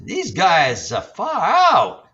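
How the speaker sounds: high, drawn-out mimicked spirit; high-pitched impression of a ghostly guy. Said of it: "another guy says".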